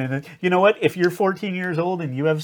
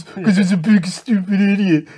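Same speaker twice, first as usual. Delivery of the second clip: high, put-on parody voice; mock husband voice; 6 semitones higher, 4 dB louder than usual. Coach describing her husband, high hammed-up parody. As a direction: ham up the idiot husband. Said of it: "he talks like this".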